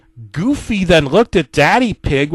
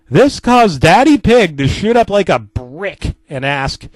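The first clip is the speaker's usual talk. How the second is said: usual speaking voice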